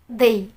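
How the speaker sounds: "they" with a blend of z and dal; correct English "they". Shown as a demonstration. Teacher mixes the two sounds, "they" with soft th. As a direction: say "they" with a slightly lisped th. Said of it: "Ada suara Z-nya sedikit".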